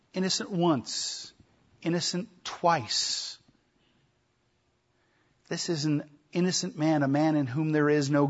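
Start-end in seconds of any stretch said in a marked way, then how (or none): 0.1-3.4 slow emphasis. Shown as a demonstration. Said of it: "emphasis on repeated verdict".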